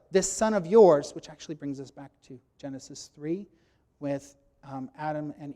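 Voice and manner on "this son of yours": slow, louder stress on yours; older son disowning his brother before the father; cold this son of yours. Acted. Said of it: "not when my brother".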